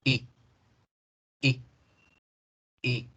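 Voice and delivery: clipped short "I" with a neutral mouth; "I" cut short, as if annoyed; very short English I. Shown as a demonstration. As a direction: say each "I" very short, lips unsmiling. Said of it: "cortísima".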